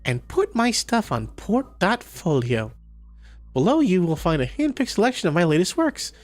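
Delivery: bubbly Cassie voice. Acted. Reading Cassie's profile aloud in a high perky character voice. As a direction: play the perky artist introducing herself.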